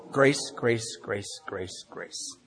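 an echo dying away into near silence; fading echo. Acted. Speaker marks a moment of grace in his story, fading echo. Repeats one word like an echo.

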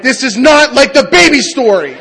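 high, loud comic outrage; retold hysterical protest, drawn out; horrified yell. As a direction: holler it in appalled disbelief. Acts horror at the birth.

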